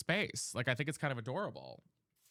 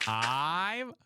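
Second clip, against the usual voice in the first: ordinary talk, nothing marked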